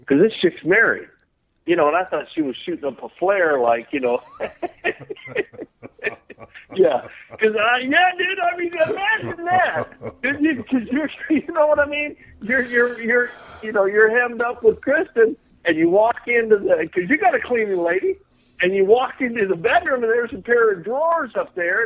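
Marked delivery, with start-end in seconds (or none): 4.2-6.3 a burst of high laughter. Real, not acted. Laughing at his own story. 6.7-21.8 higher, excited, laughing through it; laughing while telling. Real, not acted.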